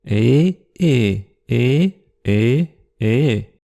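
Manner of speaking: each "et" on its own tonal contour; "et" gliding up or down in pitch, held long; tone demonstration. Shown as a demonstration. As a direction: draw a different melody on each separate "et". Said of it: "dessiner la mélodie des syllabes".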